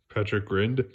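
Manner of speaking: plain reading voice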